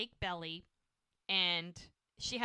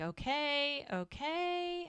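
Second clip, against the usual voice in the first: coaxing midwife; 8 semitones higher, much slower than usual. Retold or imitated. Voicing midwife during the delivery.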